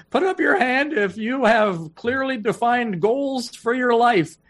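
put-on a nervous young speaker's squeak; squeaky little voice. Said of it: "my squeaky little voice".

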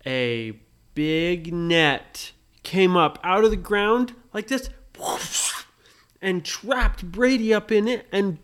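storyteller hitting the trap's climax, building; dramatic build. A narrator's reading. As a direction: swell it to the big reveal.

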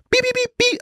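very high piercing beeps mimicking an egg timer; imitated timer beep. Mocking the egg timer's awful beep in a very high voice. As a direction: chirp it out like a cheap kitchen timer. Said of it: "It just go beep".

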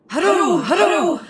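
high whooping hurrahs easing into invocation; battle cry. A narrator's reading. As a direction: cry out to the saint like a distant host. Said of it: "the Latin-bred soldier heard the cry".

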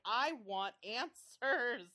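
"I want answers" drawn out slowly, each word weighted; emphatic mock demand. Voicing an impatient audience member.

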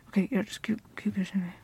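breathy whisper mid-kiss; whispering so he won't hear; hushed whisper. Acted. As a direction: keep it secret, barely voiced. Fake kiss in front of ex.

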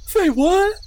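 very high, lisped squeak of disbelief; high gummy kobold, incredulous; startled toothless kobold. Acted. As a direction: squeak it through missing teeth, shocked. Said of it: "Mud, goes".

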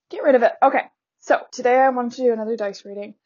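ordinary delivery

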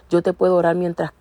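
ordinary talk, nothing marked

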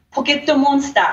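"Pocket Monster" said as Japanese poketto monsutā; Japanese pronunciation. Shown as a demonstration. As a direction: say "Pocket Monster" with Japanese vowels, ending in a long ah. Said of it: "what Pokemon means in Japanese".

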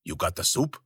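a hint of an old man, low and soft; lightly voiced creepy old man; old gravelly voice. Old man at the back door asking the goons for soup, low and soft. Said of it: "a different voice, old and gravelly".

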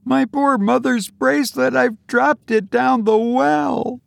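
put-on very high girlish wail of dismay; distressed cry. A narrator's reading. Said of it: "she cried in distress".

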